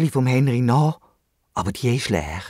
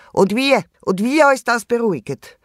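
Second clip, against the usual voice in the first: high, louder emphatic reply; emphatic eagerness; 9 semitones higher, 4 dB louder than usual. A narrator's reading. Worried Wombat answers the calm hedgehog, emphatically eager. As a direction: jump at the offer, voice raised.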